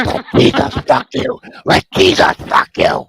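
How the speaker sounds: Exorcist demon. Acted. Showing off a horror voice-over, demonic rasp. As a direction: croak it like someone possessed. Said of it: "I can voice over The Exorcist".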